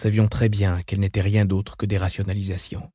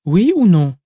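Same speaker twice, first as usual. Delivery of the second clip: insistent; 6 semitones higher, 6 dB louder than usual. Acted. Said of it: "insista Odrad".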